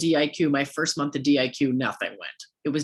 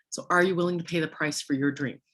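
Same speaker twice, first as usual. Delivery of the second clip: ordinary delivery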